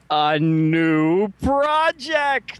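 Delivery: high, slow dramatic announcer teasing; mock announcer. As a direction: drag it out like a trailer voice.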